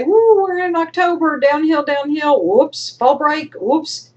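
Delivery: high, whooping downhill ride; carefree student glee. Acted. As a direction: whoop it up like you're coasting downhill. Student gleefully coasting through October.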